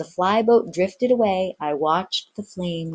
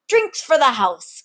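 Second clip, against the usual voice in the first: commanding; 9 semitones higher, 4 dB louder than usual. Acted. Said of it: "commanded One-Armed Ginger".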